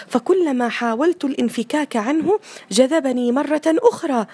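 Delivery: put-on high, loud child's lament; boy telling his mother he is a prisoner; complaining boy. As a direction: plead like a prisoner of the screen. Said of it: "قالت الأم باستغراب".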